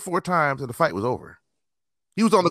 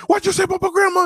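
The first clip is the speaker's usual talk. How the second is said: much higher, louder furious demand; outraged challenge; 5 dB louder, faster than usual. Retold or imitated. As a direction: play the guy exploding over his grandma insult. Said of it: "the dude went".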